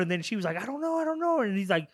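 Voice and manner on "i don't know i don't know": high-pitched flustered denial; mock helpless panic. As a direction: plead ignorance in a much higher voice. Retold captive answering interrogator.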